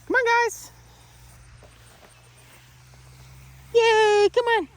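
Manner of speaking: high dog praise. Real, not acted. Trainer coaxes the puppy Doll to follow, squeaking high. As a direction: coax the puppy along in a high, excited squeal.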